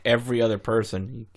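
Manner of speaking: ordinary delivery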